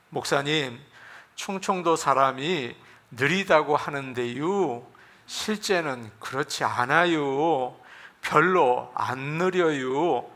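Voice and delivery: slow, drawn-out Chungcheong quote; unhurried church member, lightly mimicked; slow Chungcheong speech. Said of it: "얼마나 천천히 하시는지".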